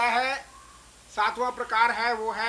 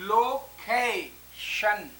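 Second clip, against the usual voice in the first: very slow location split into लो, के, शन; syllable by syllable; much slower than usual. Shown as a demonstration. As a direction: leave clear gaps in location, three separate beats. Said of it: "यहाँ पर एक syllable ये है".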